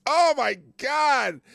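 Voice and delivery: loud, slow, disbelieving cry; a real stunned shout at an absurd name; shocked shout. Real, not acted. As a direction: yell out in outraged disbelief. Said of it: "that is such an Orwellian name".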